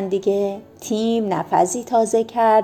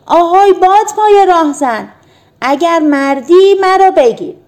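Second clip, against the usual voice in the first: high, loud teasing dare; playful challenge shout; 9 semitones higher, 9 dB louder than usual. A narrator's reading. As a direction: call down from the castle window, daring him. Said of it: "فریاد زد".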